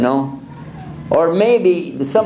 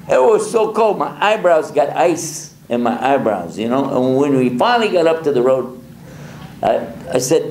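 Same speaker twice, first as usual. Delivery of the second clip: usual speaking voice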